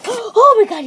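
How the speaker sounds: gasping high, loud Oh my God; sudden startled shriek. Real, not acted. Gasp, startled by someone.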